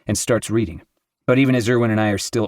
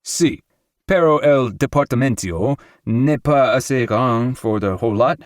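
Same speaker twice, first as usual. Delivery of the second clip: leaping into Spanish; 4 semitones higher, 4 dB louder than usual. A narrator's reading. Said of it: "leaping into Spanish".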